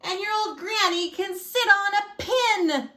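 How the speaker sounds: high-pitched taunting yell; pig yelling. A narrator's reading. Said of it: "the third little pig yelled".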